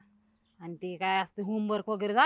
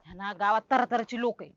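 ordinary delivery

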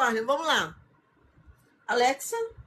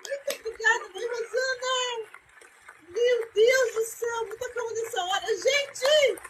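emotional thanks; 8 semitones higher than usual. Real, not acted. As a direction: catch your breath, overwhelmed. Emotional after goal.

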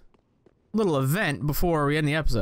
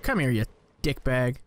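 ordinary talk

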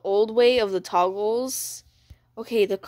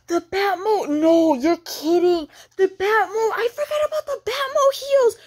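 high, thrilled squealing rush; gasp, then squeal in delighted disbelief; excited disbelief; 7 semitones higher than usual. Real, not acted. Streamer spots forgotten heels in the game, excited disbelief.

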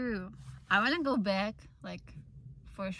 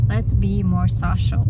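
ordinary talk, nothing marked

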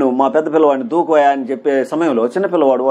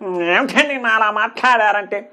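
high, slow put-on star voice, like a stage mimic; film star mimicry; 7 semitones higher, slower than usual. Teacher mimics a film hero's voice for students, high and slow. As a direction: copy a famous actor's voice for the class. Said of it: "గొంతు అనుకరణ అంటే mimicry అంటాం కదా".

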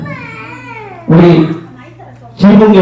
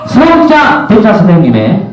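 event announcer; 13 semitones higher than usual. Real, not acted. MC cues music for the entrance, big announcer voice. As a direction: announce a grand entrance at full pitch.

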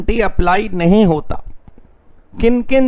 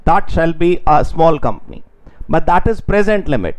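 slow dictation; much slower than usual. Shown as a demonstration. Teacher dictating notes.

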